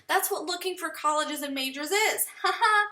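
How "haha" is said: normal talking voice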